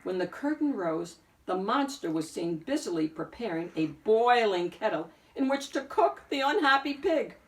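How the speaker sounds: ordinary delivery, nothing marked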